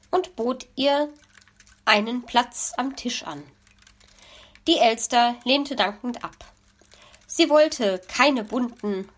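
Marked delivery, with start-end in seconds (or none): none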